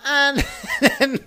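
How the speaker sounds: laughing through words. Real, not acted. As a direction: laugh while starting. Laughs mid-sentence.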